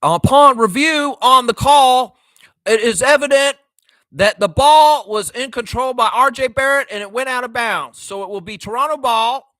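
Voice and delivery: put-on loud, high referee call in a Tennessee accent; Ben Taylor impression. Fan mimicking the referee's challenge ruling, loud Tennessee accent. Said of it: "was that a good Tennessee accent?".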